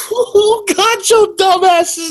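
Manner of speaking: very high, slow hooting taunt; gleeful jeer in a high pitch; mock-scandalized hooting. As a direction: crow it high, drawing the words out. Mock-scandalized reaction to the insult.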